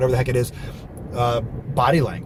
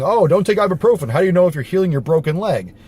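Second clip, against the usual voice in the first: mock scolding; 5 semitones higher, 4 dB louder than usual. Mock objection he disclaims making.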